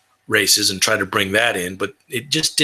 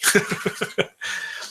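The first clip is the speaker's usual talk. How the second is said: airy laugh; 9 semitones higher, 5 dB quieter than usual. Real, not acted.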